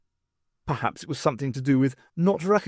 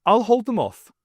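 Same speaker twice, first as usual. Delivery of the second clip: louder, brave holodrama hero; heroic line; 8 semitones higher, 6 dB louder than usual. A narrator's reading. Stokes sending his comrades away, mock heroic. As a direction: play the noble hero. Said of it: "plucking his words ... a holodrama".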